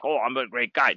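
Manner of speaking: a quick put-on of a bragger; mock self-praise. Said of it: "not to make myself look like".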